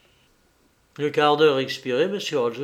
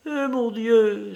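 high, drawn-out female character voice; lady traveller's high, slow exclamation; woman's exclamation; 7 semitones higher, slower than usual. A narrator's reading. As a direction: exclaim slowly in a high lady's voice. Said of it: "s'écria la voyageuse".

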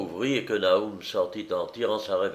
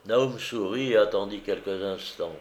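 ordinary delivery

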